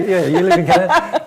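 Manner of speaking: giggling, cheerfully stubborn; laughing mock insistence. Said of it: "And I go".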